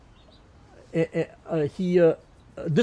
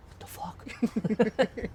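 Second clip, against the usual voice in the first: slow, higher, stunned; stunned disbelief; 7 semitones higher, 6 dB quieter, much slower than usual. Retold or imitated. Milcho stunned at meeting his double, slow high disbelief. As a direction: draw it out in disbelief.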